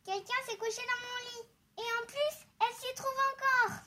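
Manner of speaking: very high little bear cub; baby bear voice. A narrator's reading. Baby bear finding intruder in bed, high excited voice.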